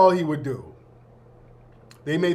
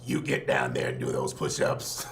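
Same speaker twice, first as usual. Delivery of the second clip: electrolarynx coach; 6 dB quieter than usual. Retold or imitated. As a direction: coach barking orders through a throat voice box, very low and buzzy. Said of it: "he talked like this".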